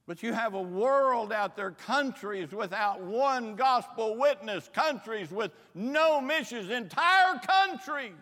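loud, high, slowing appeal that builds to a cry; preaching at full force. Acted. Preacher urging young people toward mission fields, rising cry. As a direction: lift the room with each phrase.

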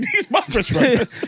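slow, drawn out through laughter; mock his possessive tone while cracking up; laughing imitation. Mocking friend's protective food line.

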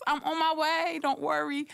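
high, bubbly yay sis; imagined cheery reply. Pretend. Imagining the sister cheering her on, high and bubbly. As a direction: sing it out sweetly.

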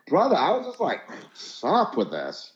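high-pitched surfer drawl that slows right down at the end; mock California surfer bro, trailing off; surfer accent. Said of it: "you'll definitely hear a guy that's like".

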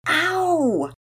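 high, drawn-out "ow"; pained yelp. A narrator's reading. Pearson's head hurts after his yell, pained yelp. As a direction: cry out at a sudden headache. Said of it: "so that his head hurt".

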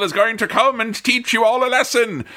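high, grandly proclaimed threat; entrance announcer. Retold or imitated. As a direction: herald the wrestler's arrival, pitched high. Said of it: "and someone is like".

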